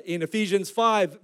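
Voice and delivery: ordinary talk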